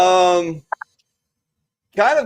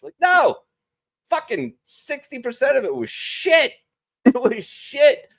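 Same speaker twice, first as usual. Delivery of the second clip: high excited protest; 13 semitones higher than usual. Real, not acted. Rejecting the nostalgia, laughing.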